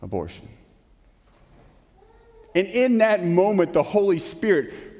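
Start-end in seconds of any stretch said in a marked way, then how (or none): 0.0-0.5 low, hushed single word; very soft. Retold or imitated. Young man admitting the bad thing to the preacher, very softly. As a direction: say it very softly, reluctantly. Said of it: "very softly".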